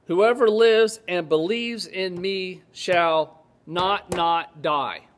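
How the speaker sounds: slow, spaced words with a doubled "not, not"; literal reading. Shown as a demonstration. Preacher showing how it reads in the Greek, literal and deliberate. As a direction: read it deliberately, "not" landing twice. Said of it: "The literal reading of this is".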